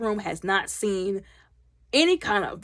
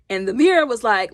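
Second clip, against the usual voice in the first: ordinary talk, nothing marked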